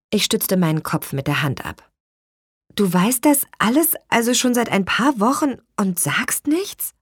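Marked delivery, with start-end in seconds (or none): 2.7-6.9 high, rising disbelief; incredulous reproach. A narrator's reading. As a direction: confront her, pitch climbing to the end. Sister confronts sister over secret.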